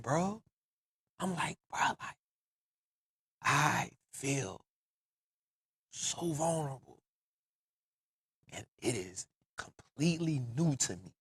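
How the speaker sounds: quiet confession. Real, not acted.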